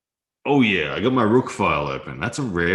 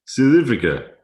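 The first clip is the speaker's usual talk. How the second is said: put-on "South Africa" as "Sith Efrica", higher and louder in a South African accent; playful South African accent, pitched up; 8 semitones higher, 8 dB louder than usual. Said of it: "I do a very good accent from South Africa".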